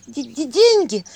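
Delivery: high, loud hiccuping stammer. A narrator's reading. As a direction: hiccup through the word. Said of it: "икнула Василиса".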